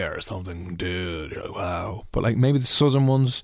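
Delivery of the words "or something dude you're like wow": slow mock LA dude accent; softer, stretched-out California drawl; drawn-out Californian. Host mocks Californian talk to a co-host, drawn-out LA accent. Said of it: "drawn out".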